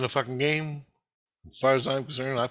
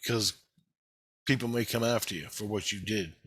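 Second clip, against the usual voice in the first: usual speaking voice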